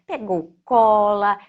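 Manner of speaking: plain reading voice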